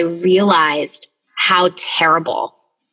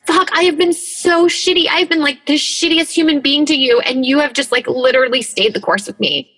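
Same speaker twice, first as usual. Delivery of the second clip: raised anguished exclaim; 7 semitones higher than usual. Retold or imitated. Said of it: "I was like".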